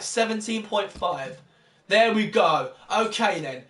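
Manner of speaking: normal talking voice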